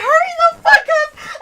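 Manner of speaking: high, loud, drawn-out panicked shriek through laughter; laughing, scared yell. Retold or imitated. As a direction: re-enact a frantic yell, half laughing. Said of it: "laughing at the same time, but, like, scared as fuck".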